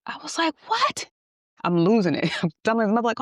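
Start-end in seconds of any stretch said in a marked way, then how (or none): 0.7-1.1 a high, piercing disbelieving what; shocked. Retold or imitated. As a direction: shoot way up in stunned disbelief. Hearing his car was shot.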